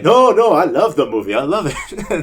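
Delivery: mock protest. Bartender's protest retold.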